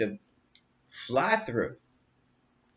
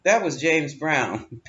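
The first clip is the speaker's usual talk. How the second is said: normal talking voice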